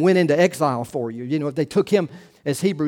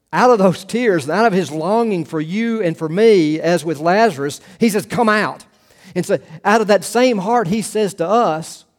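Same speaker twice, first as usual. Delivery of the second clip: rising preaching; 3 semitones higher, 4 dB louder than usual. Acted. Preaching builds toward Lazarus call.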